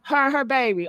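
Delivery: ordinary talk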